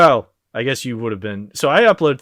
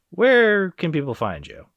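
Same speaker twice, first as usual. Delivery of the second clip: usual speaking voice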